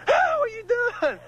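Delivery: high, slow falsetto squeal; mock outraged woman. Imitating the girlfriend's shriek for the guys. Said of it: "She goes".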